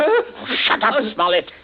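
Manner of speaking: high, loud impatient shout; angry snap. Acted. Villain angrily shouts down the stammering captive. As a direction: cut him off sharply.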